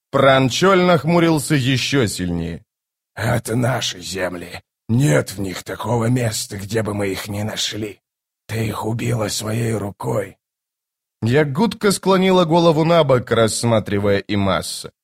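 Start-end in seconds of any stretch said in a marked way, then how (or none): 3.2-10.4 low, softened sorcerer voice; low, quiet accusation from an old-world sorcerer; grim bone caster. Acted. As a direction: speak low and restrained, as a stern shaman.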